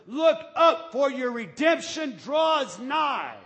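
high, loud preaching, slow and hammered; fiery preaching. Real, not acted. Pastor shouting God's love to the church. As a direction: preach it out full force to the congregation.

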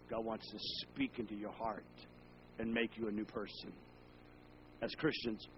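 quiet, gentle sermon voice; soft tender preaching. Acted. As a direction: draw them in softly.

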